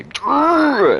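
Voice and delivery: high, loud mock growl of angry teammates; hammed-up growl; mock aggressive growl. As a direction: growl it like a fired-up jock. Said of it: "Same thing with military".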